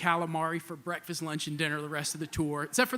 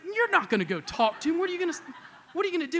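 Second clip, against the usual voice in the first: incredulous; 11 semitones higher, 5 dB louder, faster than usual. Retold or imitated. Friend refuses, arguing.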